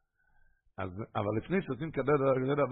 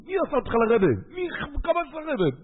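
high-pitched screaming at him, climbing ever higher; imitating a screeching angry woman; shrill yelling woman; 4 dB louder than usual. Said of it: "התחילה לצעוק עליו".

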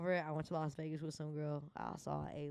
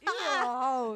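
teasing shout; 6 semitones higher, 11 dB louder, slower than usual. Pretend. Repeating the call-out.

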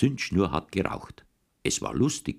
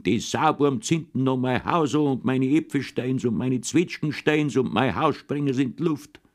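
angry yelling; 6 semitones higher than usual. A narrator's reading. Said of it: "Er hat immer geschrien".

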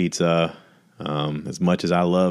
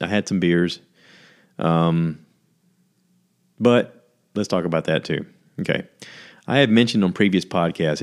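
ordinary talk, nothing marked

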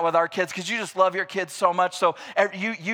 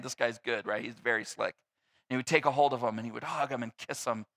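normal talking voice

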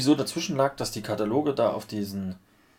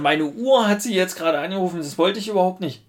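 flustered explaining; 9 semitones higher, 4 dB louder than usual. Retold or imitated. Own apologetic explanation retold.

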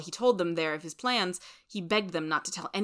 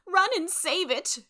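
high, drawn-out cry of despair; 12 semitones higher, 4 dB louder, slower than usual. A narrator's reading. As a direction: cry out slowly and high in desperation. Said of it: "wringing her hands in despair".

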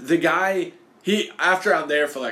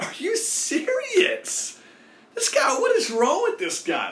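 far higher, slow, stunned disbelief; incredulous outrage; slower than usual. Retold or imitated. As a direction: drag it out in shocked disbelief. Window shut on him.

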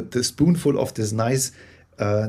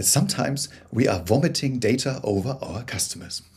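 normal talking voice

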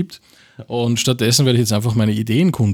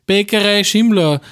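ad slogan voice; 8 semitones higher, slower than usual. Acted.

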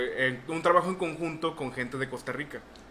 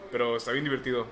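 ordinary talk, nothing marked

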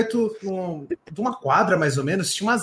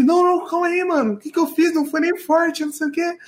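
a light, higher imitation of a flustered kid insisting it was nothing; panicky classmate; 9 semitones higher than usual. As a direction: play the boy who punched him, backpedalling in alarm. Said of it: "Aí ele".

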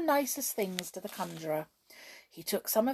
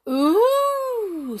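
high, loud astonished ooh; great surprise; 11 dB louder than usual. A narrator's reading. Crowd amazed at the empty basket, surprised ooh. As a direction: gasp out the wonder. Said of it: "said everyone in the greatest surprise".

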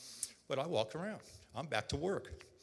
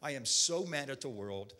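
usual speaking voice

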